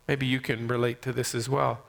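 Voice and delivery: ordinary talk, nothing marked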